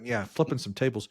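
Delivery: usual speaking voice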